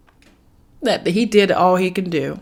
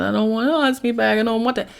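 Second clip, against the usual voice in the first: begging; 6 semitones higher, faster than usual. Retold or imitated. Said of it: "started crying and begging him".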